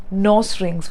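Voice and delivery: "nose rings" with NOSE as "noss"; short open O and S in "nose rings"; slow "nose rings", said as "noss rings"; mispronunciation. Shown as a demonstration. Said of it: "which is not correct".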